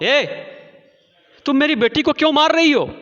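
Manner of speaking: high, fast, loud scolding; angry mother. Acted. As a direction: confront the neighbour who hit your girl. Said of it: "मलासा की माँ ने कहा".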